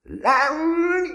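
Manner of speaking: extremely high, loud thundering command; furious roared order. A narrator's reading. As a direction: thunder it at the boy. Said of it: "mennydörgött rá".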